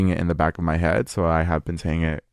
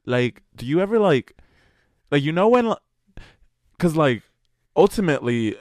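higher-pitched mock podcast chatter; mock rambling; 5 semitones higher than usual. Podcaster mimicking their own filler-heavy episode, mocking. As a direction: parody halting filler-word chatter, pitched up.

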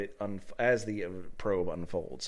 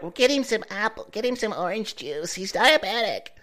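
mocking nurse; 6 semitones higher, 3 dB louder than usual. Mocking clueless nurses' advice.

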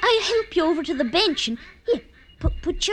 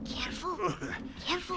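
hushed; 12 dB quieter, faster than usual. Acted. Parents asleep, lover at window.

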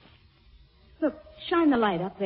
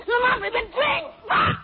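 high, loud panicked cries; terrified screams; 7 dB louder, much slower than usual. Acted. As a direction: scream for help. Attacked, screaming.

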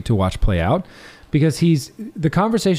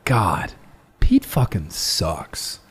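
disgusted mutter; 6 semitones lower, 3 dB quieter, much slower than usual. Retold or imitated. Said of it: "I was like".

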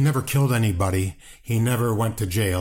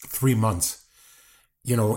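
ordinary talk, nothing marked

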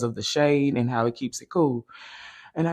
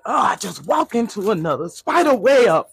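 startled; 7 semitones higher, 8 dB louder than usual. Real, not acted. Walks into a spider web.

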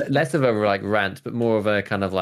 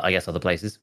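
normal talking voice

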